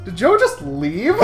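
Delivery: ordinary talk, nothing marked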